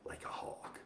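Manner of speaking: breathy mock-sinister whisper; playful spooky whisper. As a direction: hiss it like a watching hawk. Mock-menacing aside to viewer.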